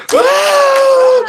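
high shouted "ah!" of overwhelm; preacher crying out for the man; ecstatic cry. Retold or imitated. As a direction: cry out like it just hit him.

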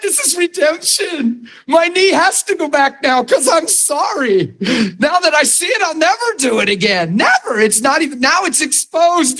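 high-pitched, elated retold outburst; acted-out excitement, pitch way up; excited. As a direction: cry it out like a joyful breakthrough. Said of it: "I got so excited".

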